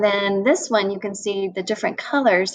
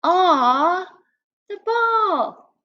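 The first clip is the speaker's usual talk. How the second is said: excited; 9 semitones higher, much slower than usual. Acted. Teacher voicing the excited player again, high cry. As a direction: cheer for the ball. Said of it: "this guy is super excited".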